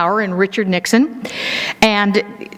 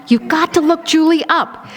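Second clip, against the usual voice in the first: mock insistence; 7 semitones higher than usual. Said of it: "Mamie Eisenhower finally wore her grandson down".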